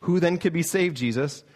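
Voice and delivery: the shocked disciples blurting it out; incredulous cry. Retold or imitated. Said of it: "that they say".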